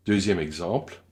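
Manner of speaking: ordinary delivery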